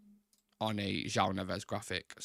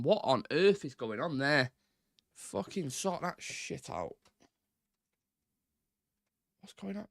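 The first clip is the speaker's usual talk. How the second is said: exasperated; 4 semitones higher, slower than usual. Real, not acted. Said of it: "Oh, I'm fuming".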